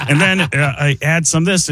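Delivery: ordinary delivery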